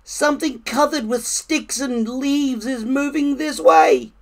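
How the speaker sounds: high-pitched squirrel voice; alarmed squirrel cry. A narrator's reading. Squirrel warning of a thing coming this way, crying out. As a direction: raise the alarm as a small woodland animal. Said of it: "cried a squirrel".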